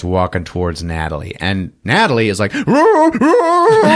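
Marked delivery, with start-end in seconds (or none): 2.7-4.0 high, loud playful roar; mock bear roar. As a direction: ham up a bear growl to scare her. Natalie mock-roaring at captor.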